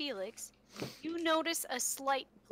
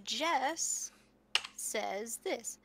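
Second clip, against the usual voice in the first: ordinary talk, nothing marked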